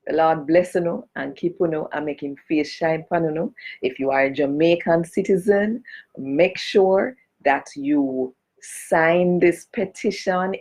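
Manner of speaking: ordinary talk, nothing marked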